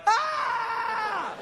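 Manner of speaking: long, very high comic shriek; mock terrified scream. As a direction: scream it out in hammed-up fright. His screamed reply.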